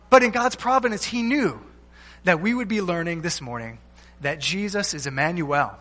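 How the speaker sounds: usual speaking voice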